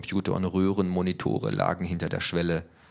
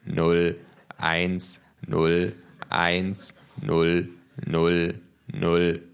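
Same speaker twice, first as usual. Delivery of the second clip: monotone, spaced-out recitation; old man reciting digits; much slower than usual. A narrator's reading. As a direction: intone each digit separately, like a machine. (monotones, abgesetztes Aufsagen)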